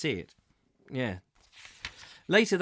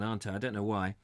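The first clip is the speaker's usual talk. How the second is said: ordinary delivery, nothing marked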